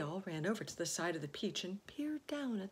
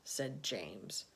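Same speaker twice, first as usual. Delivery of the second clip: ordinary delivery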